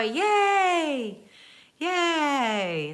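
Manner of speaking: high, loud celebratory yay; cheer. Real, not acted. Cheering baby news.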